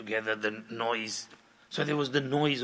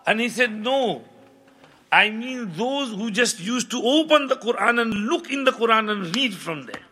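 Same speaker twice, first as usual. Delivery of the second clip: raised, higher preaching voice; fervent preaching; 7 semitones higher, 7 dB louder, faster than usual. Acted. Preacher urges listeners to love the Quran, fervent. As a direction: drive the lesson home with rising fervour.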